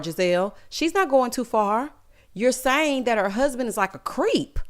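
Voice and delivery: ordinary talk, nothing marked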